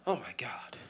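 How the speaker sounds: breathy whispered gasp of disbelief; shocked whisper. Retold or imitated. Reacting to news of the attack, hushed whisper. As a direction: whisper it as if stunned.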